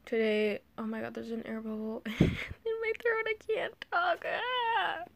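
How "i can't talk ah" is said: strained, much higher squeak; choked squeak. Real, not acted. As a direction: squeak it out past a lump in the throat. Air bubble in throat.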